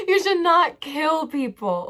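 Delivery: high, slow, hammered-home "You should not kill people"; emphatic. Real, not acted. Repeating her base point for emphasis.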